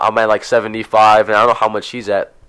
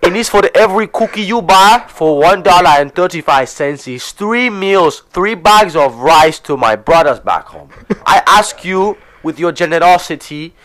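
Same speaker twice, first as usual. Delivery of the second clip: higher, mock Ugandan charity appeal; Ugandan accent; 4 semitones higher, 3 dB louder than usual. Cookie seller begs for brothers back home, mock Ugandan accent. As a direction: plead the cause in a put-on Ugandan accent. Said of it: "with a Ugandan accent".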